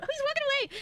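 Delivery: very high, loud squeal; sudden high outburst; excited exclamation. Real, not acted. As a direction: cry out as it happens. Watching game character leave.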